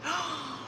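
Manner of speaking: soft, drawn gasp; excited gasp. Real, not acted. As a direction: suck in a quiet breath before cheering. Reacting before her cheer.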